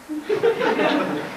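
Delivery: short high soft laugh. Real, not acted. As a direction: burst out laughing at himself. Laughs at own parody.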